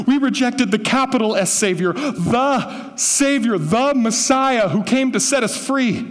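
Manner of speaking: high, loud pleading plea; impassioned Stephen. Retold or imitated. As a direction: preach it as Stephen begging them to stop. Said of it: "And Stephen is saying".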